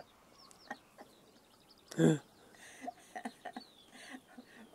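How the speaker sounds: long, helpless laugh; real laughter. Real, not acted. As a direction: laugh hard at your own story. Griner af sin egen historie. (langt, hjerteligt grin)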